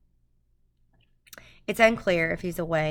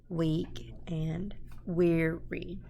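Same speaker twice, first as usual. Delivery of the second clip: whispered; 3 dB quieter, slower than usual. Shown as a demonstration. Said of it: "sounds really strange to say it that way".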